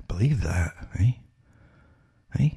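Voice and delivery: breathy disbelieving whisper; whispered scoff. Real, not acted. Scoffing to viewers, whispered. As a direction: whisper it with a sneer.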